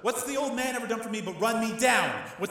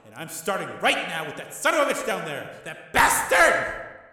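loud, much higher raging tirade; enraged tirade; 11 semitones higher, 8 dB louder than usual. A narrator's reading. Tommy raging at his brother, loud tirade. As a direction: rage at everyone.

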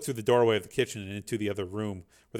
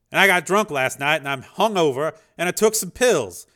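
higher, louder rush; manic; 6 semitones higher, 9 dB louder than usual. A narrator's reading. Dev confessing to the program, manic. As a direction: spill it out manically. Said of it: "sounding manic".

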